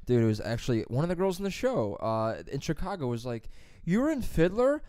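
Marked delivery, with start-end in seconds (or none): 3.8-4.8 put-on much higher, louder girlish surprise; excited girl. Castmate recognising him, high excited voice.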